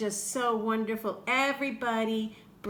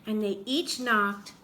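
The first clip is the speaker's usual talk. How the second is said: ordinary delivery, nothing marked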